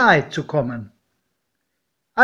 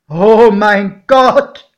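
very loud, slow, higher cry of dread; terrified exclamation; 5 semitones higher, 10 dB louder, much slower than usual. A narrator's reading. Godfather sees the steaming locomotive, very loud slow cry. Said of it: "rief mein Pate".

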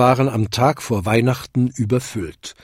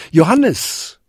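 higher, louder call of a name; 6 semitones higher, 4 dB louder than usual. A narrator's reading. Jakob calls down to his son Johannes, calling out. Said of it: "rief er".

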